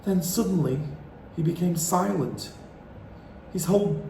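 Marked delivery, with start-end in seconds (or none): none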